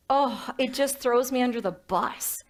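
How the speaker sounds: usual speaking voice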